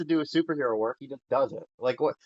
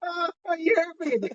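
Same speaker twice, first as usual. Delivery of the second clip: mock Karen wail; 13 semitones higher, 4 dB quieter than usual. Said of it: "those Karens that they freak out".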